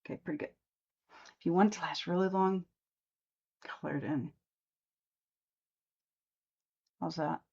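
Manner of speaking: talking while lining lips; words through a lipstick mouth; while putting on lipstick. Real, not acted. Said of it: "It's hard to talk and do lip liner and lipstick".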